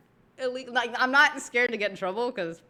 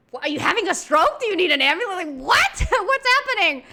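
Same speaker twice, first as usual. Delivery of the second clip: loud, high, startled questions breaking into a laugh; alarmed disbelief; 10 semitones higher, 8 dB louder than usual. Retold or imitated. To her dad at the game table, loud alarmed disbelief. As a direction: fire off the questions in mock panic. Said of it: "I was like".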